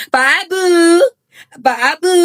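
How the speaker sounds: calling out high across the room. Real, not acted. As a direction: call his name to summon him.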